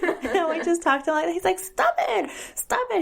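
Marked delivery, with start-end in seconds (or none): 1.6-3.0 high, whiny kid's protest, lightly imitated; annoyed child. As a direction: imitate a fed-up little boy. Son getting mad.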